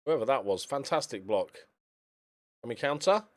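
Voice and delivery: usual speaking voice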